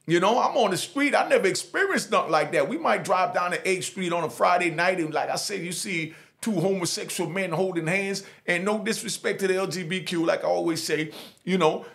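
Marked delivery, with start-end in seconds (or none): none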